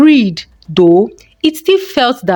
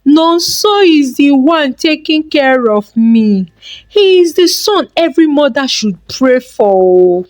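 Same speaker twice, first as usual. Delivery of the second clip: proud boast; 6 semitones higher than usual. Acted. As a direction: boast about the favourite son to the public. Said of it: "she would proudly say".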